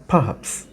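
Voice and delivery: "perhaps" as puh-HAPS, no r; weak first syllable. Shown as a demonstration. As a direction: say "perhaps" softly, a puff of p and then haps. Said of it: "দেখেছেন কীভাবে উচ্চারণ করেছি". ("perhaps" র ছাড়া, দুর্বল প দিয়ে)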